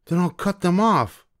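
mother demanding at the witch's hut, very high and loud; calling out. Acted. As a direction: demand at the door. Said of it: "the mother said".

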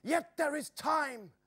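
high and slow, breaking silence; urgent cry. A narrator's reading. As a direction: lift it with sudden hope. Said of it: "Joris broke silence with".

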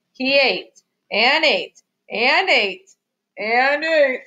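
slow, weighted beat on each "ate"; plodding storybook repetition; drawn-out repetition. A narrator's reading. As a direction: stretch each "and ate" as if endless. Stretches repetition for endless eating.

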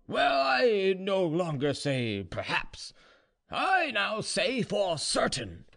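put-on slow, high drunken declaration; drunk Ivan. A narrator's reading. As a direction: announce it tipsily, high and drawn out. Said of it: "getting more and more drunk".

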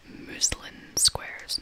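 ordinary delivery

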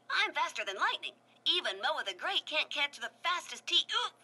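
a speedy show-off racing his words; fast boastful tiki. A narrator's reading. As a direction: rattle it off fast and cocky. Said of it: "raced around everyone".

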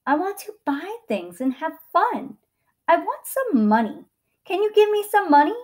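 put-on high, loud, pleading boy; demanding boy. A narrator's reading. Boy asking the tree for money, high and demanding. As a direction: push the request, pitch climbing to the end.

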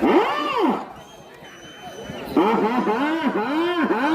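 slow, rolling "aa-haa-haa" cry; theatrical slow laugh-like whoop; drawn-out showman's "ha-ha". Real, not acted. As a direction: stretch each "haa" out for the crowd. Commentator's showy cries to crowd.

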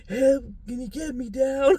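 loud, slow, high comic plea; mock cry for help. Playing a shadow figure for Dave, mock plea. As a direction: call out as a stranded little puppet.

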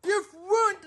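very high, strained screams of fury; shrieking rage. Acted. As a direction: lose it completely at the kid. Kid smashing plates, dad freaks.

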